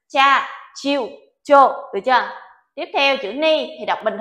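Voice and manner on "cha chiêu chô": "Cha, chiêu, chô" read slowly and clearly, without the y sound; demo reading. Shown as a demonstration. Teacher reading for students to copy, slow clear demo. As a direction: spell out each syllable slowly. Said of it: "Sensei đọc cho các bạn xem ha".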